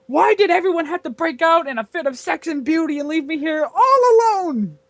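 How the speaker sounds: dramatic lament rising high and loud at the end; hammed-up despairing proclamation; proclaiming. A narrator's reading. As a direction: wail it out like a tragic heroine, slowing on the end. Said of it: "and proclaimed".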